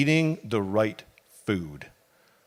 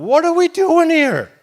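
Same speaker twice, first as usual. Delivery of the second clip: high, loud cry of frustration; yelling out in the desert, fed up; anguished shout; 11 dB louder than usual. Acted. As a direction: shout it up at the sky. Wanderer cries out in desert.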